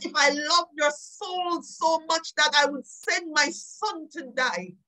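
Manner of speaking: normal talking voice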